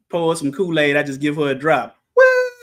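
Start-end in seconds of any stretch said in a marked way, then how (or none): 2.1-2.5 high squeaky mock what; Rachel's what. Imitating Rachel wanting more Kool-Aid, high. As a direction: chirp it like Rachel. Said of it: "That's what Rachel say sometimes".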